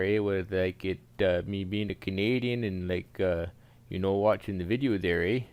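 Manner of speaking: hammed-up Canadian accent; thick comic Canadian accent. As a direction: play it as a folksy Canadian with a sing-song "eh".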